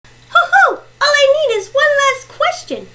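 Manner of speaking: high whoop into quick, eager talk; whoop, then rush on pitched high; excited contestant. Acted. Player two wanting one last question, excited.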